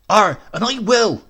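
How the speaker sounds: high, loud huffy retort; a shrieking offended housekeeper; defiant housekeeper. A narrator's reading. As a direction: fling it back at him from the doorway. Housekeeper's defiant last word.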